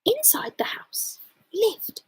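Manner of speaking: hushed eerie storyteller whisper; spooky whisper. A narrator's reading. Narrator building dread, whispered. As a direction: drop to a creepy whisper.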